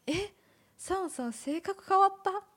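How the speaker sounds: mock amazement in short bursts; imitated surprise. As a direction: act out friends' startled double-take. Quoting friends surprised at changed personality.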